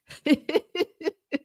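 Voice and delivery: bursting into real laughter; laughing hard at her own story. Real, not acted. Telling her viewers about the officer, laughing hard.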